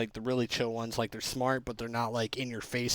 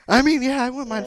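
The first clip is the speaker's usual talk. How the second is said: laughing; 12 semitones higher, 9 dB louder than usual. Real, not acted. Joking laugh.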